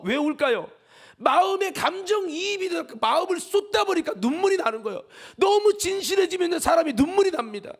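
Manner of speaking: high, loud, pressing sermon; impassioned preaching. Acted. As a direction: pour your heart into it. Preaching at full force.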